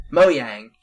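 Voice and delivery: "Mo Yang" with a Y sound starting "Yang"; low, isolated "Mo Yang", Y as in yes; pronunciation demo. Shown as a demonstration. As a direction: say "Mo Yang" low, with YANG as the second word. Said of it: "edit in multiple pronunciations".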